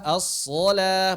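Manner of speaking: as-shalah said slowly with a thick alif, like asolah; correct heavy reading. Shown as a demonstration. Teacher demonstrates the right reading, slowly. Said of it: "Makanya as-shalah".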